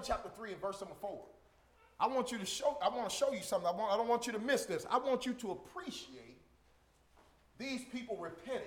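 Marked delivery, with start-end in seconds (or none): none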